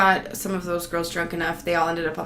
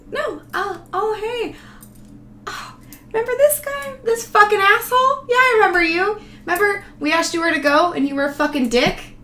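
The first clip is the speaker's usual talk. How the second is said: high, louder mocking sing-song; 14 semitones higher, 6 dB louder than usual. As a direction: sarcastically greet the jerk as if delighted. Mocking the rude local at bar.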